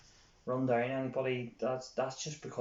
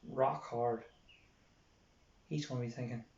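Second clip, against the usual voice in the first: ordinary talk, nothing marked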